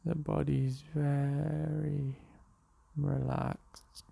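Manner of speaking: unhurried meditation voice with long pauses; guided meditation. Real, not acted. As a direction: guide them gently into the breath, leaving space between phrases. Leads a morning meditation.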